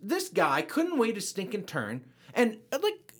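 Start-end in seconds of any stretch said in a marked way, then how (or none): 0.0-2.0 ticked off. Real, not acted. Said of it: "Well, I'm ticked".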